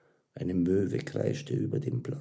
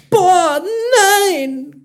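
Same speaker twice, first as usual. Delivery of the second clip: very high, loud drawn-out groan; dismayed outburst; 13 dB louder than usual. A narrator's reading. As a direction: cry out in comic self-reproach. Mira slaps forehead, realising mistake.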